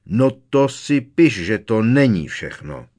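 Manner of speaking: loud, slow, booming threat; angry uncle laying down the law loudly; thundering. A narrator's reading. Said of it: "zahřměl strýc Vernon".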